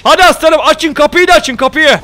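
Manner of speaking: rally the men at the gate; rallying shout. Real, not acted. Urging troops at gate.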